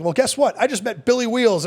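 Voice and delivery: high, shouted crowd hype; hyped stage announcement. Retold or imitated. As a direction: work the room up. Comedian hyping crowd.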